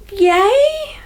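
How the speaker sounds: high, questioning little cheer; hammed-up uncertain yay, rising; unsure cheer. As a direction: celebrate while still in doubt. Acting Thomas's mixed reaction.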